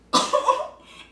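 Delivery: high, loud attention-seeking cough; imitated fake cough. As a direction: mimic a child coughing to be noticed. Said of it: "기침 소리도 내고". (기척 내는 아이의 헛기침 흉내)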